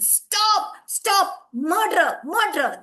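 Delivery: high, loud cries of murderer; shouting after him. A narrator's reading. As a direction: yell after the fleeing man. Sons chase the killer.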